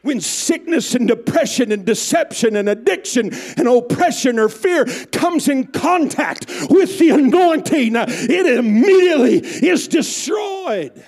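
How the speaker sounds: loud, high, building sermon climax; preaching at full force. Acted. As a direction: preach it with fire.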